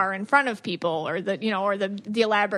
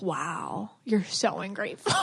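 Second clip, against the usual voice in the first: mock sneering parent; 4 semitones lower, 6 dB quieter, slower than usual. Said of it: "they're like".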